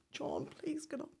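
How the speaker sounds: soft, high, broken plea to her partner on the road; begging him quietly to get up; quiet pleading. Retold or imitated. Woman pleading softly with her shot partner to get up.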